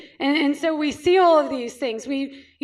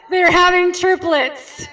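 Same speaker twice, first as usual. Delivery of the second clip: louder and higher, slow joyful announcement; excited; 3 semitones higher, 6 dB louder, slower than usual. Real, not acted. Chiropractor revealing the couple's triplets, excited and loud. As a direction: proclaim the big news with delight.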